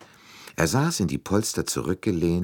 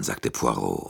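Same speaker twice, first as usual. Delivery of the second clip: usual speaking voice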